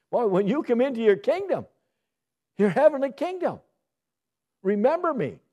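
loud, high pleading cry; dying thief calls out to Jesus. Acted. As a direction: beg the king to remember him.

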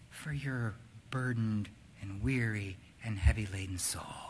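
soft, slow, hushed and reassuring; tender invitation. Acted. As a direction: offer rest gently, almost whispering. Quoting Jesus' invitation to rest.

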